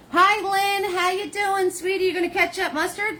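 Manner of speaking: high, bright hello to a friend; high-pitched sweet hello to a viewer; cheery greeting. Real, not acted.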